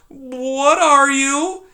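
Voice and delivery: high, slow, trembling question; high slow frightened mouse voice. Acted. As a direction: ask in dread. Poppy voiced in story.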